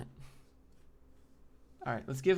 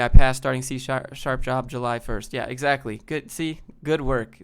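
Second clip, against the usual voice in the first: normal talking voice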